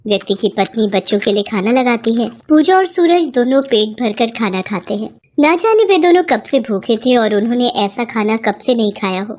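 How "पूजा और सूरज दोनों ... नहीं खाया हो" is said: ordinary delivery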